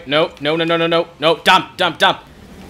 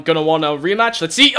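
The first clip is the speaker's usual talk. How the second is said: normal talking voice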